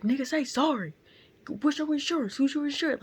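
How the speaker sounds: loud, high-pitched retold tirade; going in. Bystander demanding insurance from the driver, imitated loud tirade. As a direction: lay into him with sharp demands, imitating the bystander. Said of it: "he was, like, going in".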